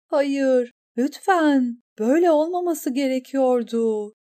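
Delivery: high, pleading anguish; desperate plea. A narrator's reading. Eriyen pleads as the knight goes down, desperate. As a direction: beg in raw panic.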